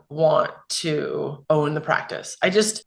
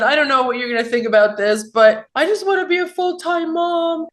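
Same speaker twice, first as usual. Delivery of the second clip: tearful confession; 10 semitones higher than usual. Retold or imitated. Crying, telling husband her wish.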